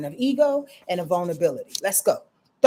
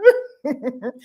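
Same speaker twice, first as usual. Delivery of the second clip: higher, louder giggle; laughing; 4 semitones higher, 6 dB louder than usual. Real, not acted. Host finishing the mock-crying bit, giggle. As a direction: crack up at your own act.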